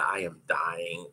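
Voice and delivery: soft, slow, drained groan of a line; mock dying. Skater mid-race thinking she's finished, slow and soft. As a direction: sag into it like you're fading on the track. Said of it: "I felt like".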